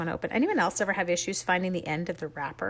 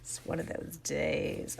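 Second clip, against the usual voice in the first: slow, soft whisper, giving up; resigned whisper; 5 semitones lower, 7 dB quieter, slower than usual. Real, not acted. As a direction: sigh it out under your breath. Resigned aside.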